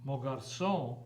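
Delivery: ordinary delivery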